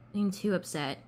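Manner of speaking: ordinary delivery, nothing marked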